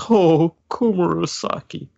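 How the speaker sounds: put-on high, slow, swooning old man; lovestruck sigh. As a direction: sigh the name like a smitten old fool. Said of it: "And he goes".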